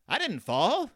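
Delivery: high, loud put-on farmer voice; hammed-up farmer's protest; Farmer Ben voice. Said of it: "That's my Farmer Ben voice".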